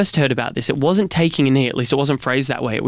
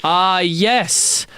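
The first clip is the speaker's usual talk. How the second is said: archaic speech; 3 semitones higher, much slower than usual. Retold or imitated. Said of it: "blow the dust off this insane word fossil".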